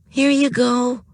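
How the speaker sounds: put-on high squeaky baby talk. A narrator's reading. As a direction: coo it like to a baby. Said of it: "in her best squeaky baby voice".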